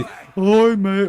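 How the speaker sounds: loud, high mock-British greeting; exaggerated British accent. Shown as a demonstration. Streamer showing what their accent is not, exaggerated British accent. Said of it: "It's not like a really".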